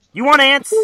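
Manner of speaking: high, very loud hammed-up yell; mock furious scolding. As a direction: berate him at the top of your voice. Acts Amanda berating Max.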